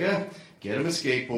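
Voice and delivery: low, grudging grunt into the line; weary obligation. Retold or imitated. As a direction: recite it like a tiresome duty. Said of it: "like he was obligated to do".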